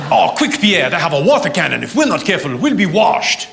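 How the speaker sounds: hammed-up French rioter; French accent. French rioter warns Pierre about water cannon, mock French accent. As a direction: warn a comrade in a comic French accent. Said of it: "French people rioting".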